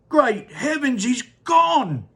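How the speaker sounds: high, loud dismay; shocked shout. Acted. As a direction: exclaim in shock. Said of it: "shouted Mister T V".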